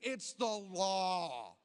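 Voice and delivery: slow, mock-solemn declaration. Telling his buddies drinking outside is the law, mock solemn. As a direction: lay it down like sacred law.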